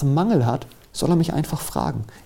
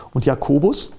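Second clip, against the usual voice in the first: ordinary talk, nothing marked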